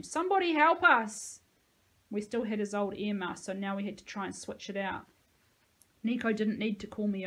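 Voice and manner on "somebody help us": high, loud desperate cry; screaming for help. A narrator's reading. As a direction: scream for rescue, pitched way up. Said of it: "Sahalia started to scream".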